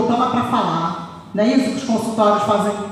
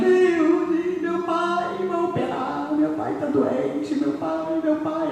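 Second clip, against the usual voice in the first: high, soft distressed pleading; panicked caller; 6 semitones higher, 5 dB quieter, much slower than usual. Retold or imitated. Ex-sister-in-law calls about her sick father, high frightened voice. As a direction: voice her frightened phone call.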